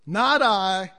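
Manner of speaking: "Not I" punched loud; loud emphatic "Not I"; stress on Not I. Real, not acted. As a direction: hit "Not I" hard, then drop. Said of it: "but Christ".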